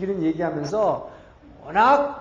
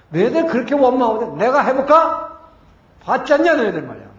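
raised, loud retold rebuke; angry challenge; 5 semitones higher, 6 dB louder than usual. Moses confronts the complaining people at the rock, angry challenge. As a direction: act out an indignant leader challenging the crowd.

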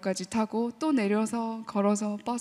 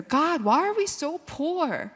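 very slow, drawn-out whine; slow complaint to God; 3 semitones higher, slower than usual. Retold or imitated. Poor child complaining to God, slow pouty whine. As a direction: drag out the grievance, pouty. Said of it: "하나님한테 대한 불만이 있었어요".

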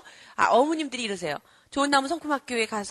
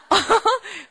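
short amused laugh; 9 semitones higher, 6 dB louder than usual. Real, not acted. Speaker amused by the child's success, laughing. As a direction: burst of laughter at the story.

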